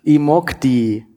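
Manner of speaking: slow, broad Bavarian dialect. Shown as a demonstration. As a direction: drawl it in thick Bavarian. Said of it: "Ich, das heißt, das heißt".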